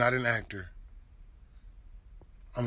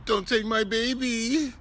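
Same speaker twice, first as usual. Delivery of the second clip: higher, whimpering mock plea; mock whiny plea; 6 semitones higher than usual. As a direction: mimic a whining crybaby, pitched up. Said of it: "You sound like a bitch".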